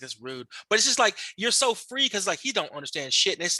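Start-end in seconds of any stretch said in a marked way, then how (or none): 0.0-0.5 very soft, low imitated aside; quiet disapproval. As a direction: say it under your breath, as the coworker did. Coworker quietly disapproving of insults.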